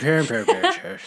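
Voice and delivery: somnambulist garbled into playful nonsense syllables, laughing; mock mumbling. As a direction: mumble right through "Somnambulist" as a joke. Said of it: "I just mumbled right through that word".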